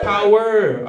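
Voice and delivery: high, loud one-word shout; mock rally shout. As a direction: punch it like a rally cry. Crowd callout after Irish Car Bomb.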